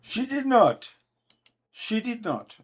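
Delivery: high, loud, firm "She did not"; emphatic denial. Real, not acted. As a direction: shut the claim down hard. Caller flatly denies the claim.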